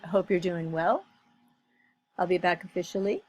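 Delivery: "well" and "officially" lifted up at the end, like questions; rising intonation demo. Shown as a demonstration. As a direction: show the uptalk, every line ending upward. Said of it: "So everything is rising intonation".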